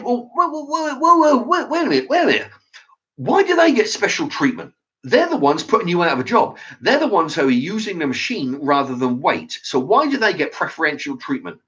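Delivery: whoa, wait, rising up in disbelief at the unfairness; indignant protest. Retold or imitated. Retold protest at the till clerk.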